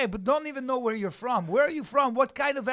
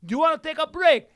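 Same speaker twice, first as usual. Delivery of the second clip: raised, loud voice of a challenger; mock taunting question; 6 semitones higher, 7 dB louder, faster than usual. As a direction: imitate someone loudly needling you. Acts out people asking him.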